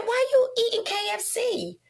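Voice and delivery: high-pitched retold puzzled question; mock puzzlement. Acted puzzled question, retold call.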